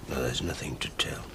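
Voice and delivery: low, whispered evasion; near-silent reply; hushed denial. Acted. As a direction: shut the question down under your breath. Suspect shrinks from probing questions.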